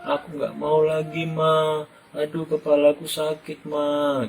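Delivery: put-on boy sobbing sorry to his mother; crying child apologizing. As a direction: beg forgiveness like a sobbing little boy. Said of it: "Sambil menangis anak itu bilang".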